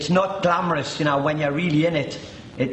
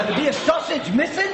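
laughing; 10 semitones higher than usual. Real, not acted. Laughing at prison food memory.

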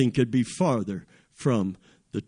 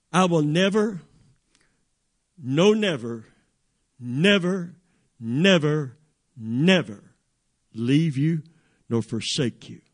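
each "never" set apart by a pause and hit afresh; emphatic repetition. Shown as a demonstration. Preacher showing the promise to the fifth power, emphatically. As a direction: pound out "never" one at a time, then settle on "forsake you". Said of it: "They heard it like this".

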